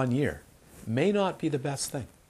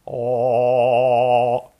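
loud, sustained "oh"; big, held-out exclamation; demonstrated loud tone; 11 dB louder than usual. Acted. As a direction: let one long vowel ring out. Acting out sudden improvement.

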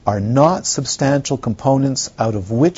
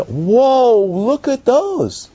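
very high, slow, louder whoa; imitating awe; 10 semitones higher, 3 dB louder, much slower than usual. Visitors touch the crystals, imitated high awe. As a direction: swoon over the crystals. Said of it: "people touch the cry".

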